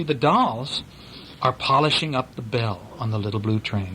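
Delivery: ordinary delivery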